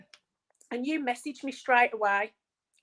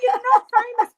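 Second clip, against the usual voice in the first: much higher and louder, bright teasing protest; playful, excited insistence; 11 semitones higher, 10 dB louder than usual. Real, not acted. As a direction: fire it back with a laughing grin. Guest teasing back, laughing.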